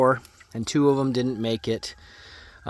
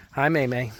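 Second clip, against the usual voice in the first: ordinary talk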